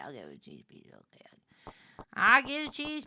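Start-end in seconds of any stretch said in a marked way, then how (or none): 0.0-1.2 whisper. Real, not acted. Whispered aside.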